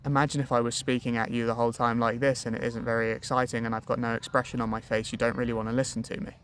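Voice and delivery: expressionless single-toned drone; monotone. Shown as a demonstration. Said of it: "He was very monotonous, single-toned".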